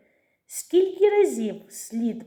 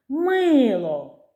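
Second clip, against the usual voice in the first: "Мило" called higher, first syllable held long; calling the word; 4 semitones higher, 4 dB louder than usual. Shown as a demonstration. Teacher finding the stress, calling "Мило" with a long first syllable. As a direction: call out "Мило", stretching MYY then falling. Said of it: "погукаючи його".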